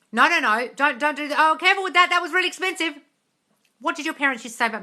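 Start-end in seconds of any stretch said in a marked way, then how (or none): 0.1-3.0 scolding parent. Acted. Voicing parents scolding about money.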